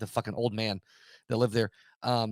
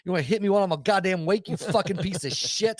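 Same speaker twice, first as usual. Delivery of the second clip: higher, louder furious challenge; retold angry yelling; 6 semitones higher, 4 dB louder than usual. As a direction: yell at the unseen attacker like picking a fight. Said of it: "like, yelling".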